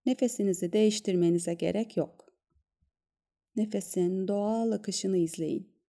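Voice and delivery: soft, calm guided meditation voice; soft spa whisper. Acted. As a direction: gently guide them into stillness. Leads a breath-awareness meditation.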